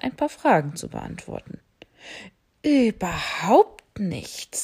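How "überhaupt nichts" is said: slow, unvoiced, louder airy bat reply. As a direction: bat happily agreeing. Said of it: "sagte die Fledermaus". (langsam, gehaucht)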